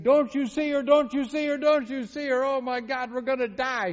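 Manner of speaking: very high, loud panicked wail; hysterical crying. Retold or imitated. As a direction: imitate a terrified woman pleading at the top of her voice. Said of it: "She's crying".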